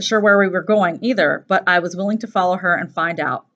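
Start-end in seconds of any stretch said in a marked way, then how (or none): none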